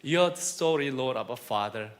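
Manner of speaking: slow, soft earnest prayer. Acted. Preacher praying softly to the Lord, slow and hushed. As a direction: pray it quietly and slowly, addressing God. Closing prayer before the sermon.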